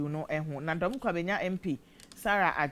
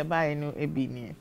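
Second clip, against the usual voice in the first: normal talking voice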